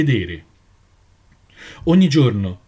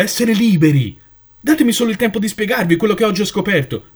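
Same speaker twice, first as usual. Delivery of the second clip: higher, louder, urgent pleading; passionate plea; 7 semitones higher, 4 dB louder than usual. Acted. Jonathan pleads his discovery to the flock, impassioned and raised. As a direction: fight for them to understand.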